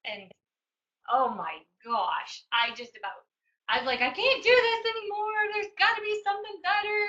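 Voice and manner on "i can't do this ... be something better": much higher and louder, voice cracking in despair; breaking down crying. Retold or imitated. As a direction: cry it out like a mom at her limit.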